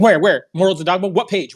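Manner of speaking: high, pushy mock of a nagging woman; mocking Taylor.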